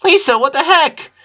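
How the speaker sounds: high, loud startled protest; shocked outcry. A narrator's reading. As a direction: cry out in shock. Shocked at tourniquet.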